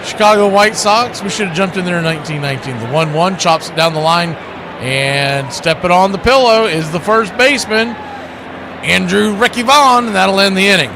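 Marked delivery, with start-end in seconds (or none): 2.8-10.9 play-by-play. Acted. Calling the game play-by-play.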